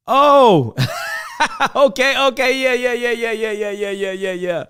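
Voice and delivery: high, laughing burst that settles down; real laughter. Real, not acted. Reacting to a viewer's comment, laughing out loud. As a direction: crack up and ride it out.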